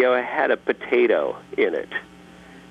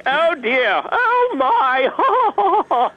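high, fluttery, dainty gasp; effeminate voice; 4 dB louder, much slower than usual. Acted. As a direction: clutch the pearls, much higher and louder. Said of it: "with the effeminate voice again".

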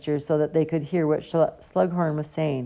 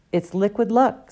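a touch higher and louder, quick to answer; excited; 5 semitones higher, 5 dB louder than usual. A narrator's reading. Hermione answers the professor, mildly excited. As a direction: blurt it like the star pupil. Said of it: "said Hermione excitedly".

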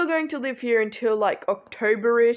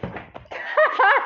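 high laugh; 14 semitones higher than usual. Real, not acted. Laughs at brother's aside.

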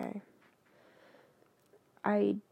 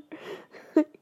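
high, loud laughing outburst; loud burst of laughter; 6 semitones higher, 7 dB louder than usual. Real, not acted. Bursts out laughing after reading.

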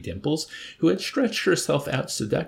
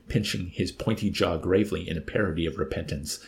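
usual speaking voice

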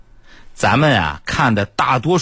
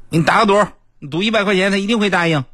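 eager urging voice; 9 semitones higher, faster than usual. Retold or imitated. Friend urges a bet.

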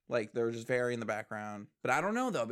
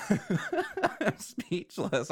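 slow, laughing through the words; 9 semitones higher, much slower than usual. Real, not acted. Reacting to a song lyric, laughing speechlessly.

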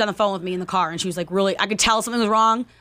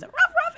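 high little mock barks; dog bark imitation. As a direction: yap like an annoying dog.

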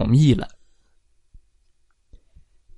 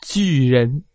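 plain reading voice

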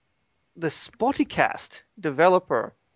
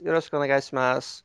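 fast Japanese phrase Yoroshiku onegaishimasu; playful Japanese; much faster than usual. Pretend. Host jokes by switching to Japanese. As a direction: rattle off Yoroshiku onegaishimasu quickly. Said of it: "speaking in different languages".

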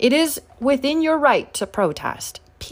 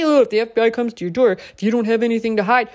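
mocking quote; faster than usual. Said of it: "isn't that what they say".

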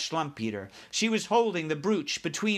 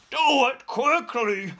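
high, loud, drawn-out plea; panicked shout; 9 semitones higher, 6 dB louder, slower than usual. A narrator's reading. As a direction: shout it slowly and desperately. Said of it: "shouted the flesh lump eater".

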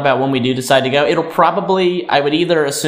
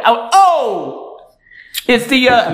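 mock surprised exclamation; 9 semitones higher, much slower than usual. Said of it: "I went".

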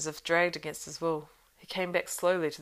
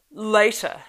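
loud, raised outraged cry; risen to a scream; 5 semitones higher, 11 dB louder than usual. A narrator's reading. Saruman twisted with rage at Gandalf's terms, near-screaming. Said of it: "his voice rose to a scream".

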